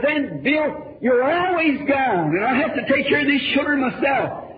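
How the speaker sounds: high, sharp scolding wife; snapping back at him, raising her voice; snappy retort. Retold or imitated. Wife snapping at her husband about the children, scolding. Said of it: "said something to me snappy".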